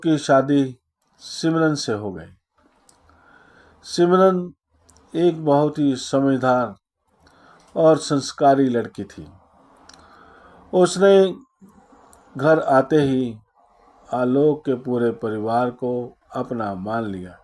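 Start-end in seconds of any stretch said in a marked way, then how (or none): none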